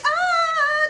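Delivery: high, loud drawn-out kiddie "ah"; little kid imitation. Host mocking how a wrestler sounds, high little-kid imitation. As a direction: mimic a little kid's high voice. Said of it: "talking like a little kid".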